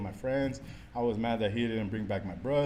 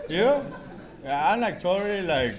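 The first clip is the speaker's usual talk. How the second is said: much higher and louder, laughing through it; 7 semitones higher, 6 dB louder than usual. Real, not acted. Recovering addict laughing at his own church antics, high and loud. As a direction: crack up at your own story.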